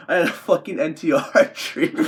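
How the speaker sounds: high, loud voice cracking into laughter; laughing disbelief. Real, not acted. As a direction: exclaim it, then burst out laughing.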